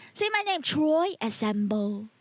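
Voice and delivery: usual speaking voice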